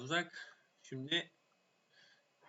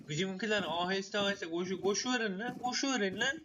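higher shouted order to run; yelling; 7 semitones higher, 4 dB louder than usual. Real, not acted. Yelling at his troops. (yükselen bağırış)

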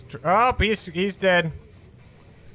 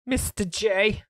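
Harley Quinn voice; 7 semitones higher, slower than usual. Pretend.

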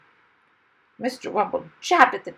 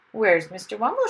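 louder, stern demand; 3 semitones higher, 6 dB louder than usual. A narrator's reading. Wife arriving to look for her husband, stern. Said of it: "she said in a stern voice".

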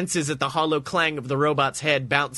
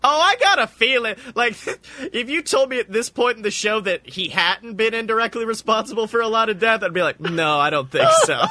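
laughing aside; 8 semitones higher than usual. Real, not acted. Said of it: "narrator aside laughing".

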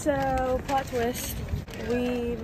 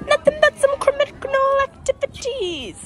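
high, put-on pun delivery; high mock voice; 5 dB louder than usual. Vlogger repeats her tea pun in a high put-on voice. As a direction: squeak the joke high.

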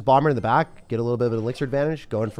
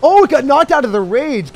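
excited; 13 semitones higher, 11 dB louder than usual. Real, not acted. Enemy giant knocked back.

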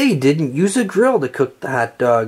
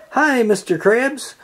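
cheerful greeting; 7 semitones higher than usual. A narrator's reading. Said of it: "SpongeBob asked".

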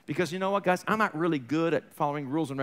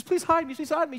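higher pleading voice, begging twice; desperate plea; 9 semitones higher than usual. Retold or imitated. Fleeing woman begs the widow to hide her, higher pleading. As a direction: beg to be hidden from the Gestapo.

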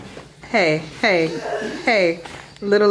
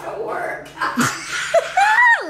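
shrieking laugh, much higher; high burst of laughter. Real, not acted. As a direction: let out a high cackling laugh. Bet over a silly card.

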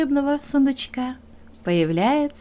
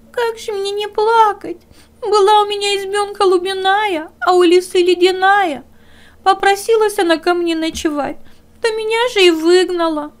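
high, louder tearful little hare voice; weeping hare; 9 semitones higher, 6 dB louder than usual. Acted. Hare complains to the dog, high and tearful. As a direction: whimper your woe like a small hare.

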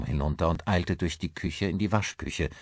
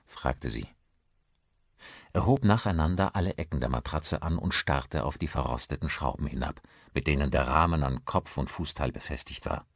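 plain reading voice

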